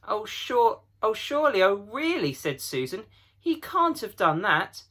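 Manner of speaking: high, pleading denial; shocked disbelief. Acted. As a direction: refuse to believe it. Susan protesting in disbelief.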